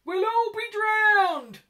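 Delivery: very high, loud, slow, drawn-out squeak; a tiny mouse wailing in panic. A narrator's reading. Mouse squeaking in panic to the other animals. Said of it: "squeaked the mouse".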